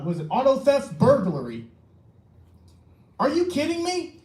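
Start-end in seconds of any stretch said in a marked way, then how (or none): 3.2-4.1 exasperated outburst. Real, not acted. Citizen at council meeting, outraged at police, exasperated outburst. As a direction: pitch jumps way up, disbelieving and fed up. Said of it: "I'm actually pretty damn frustrated".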